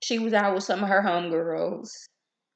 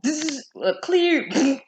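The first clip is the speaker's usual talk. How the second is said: ordinary talk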